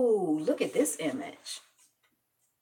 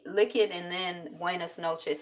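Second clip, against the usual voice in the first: ordinary delivery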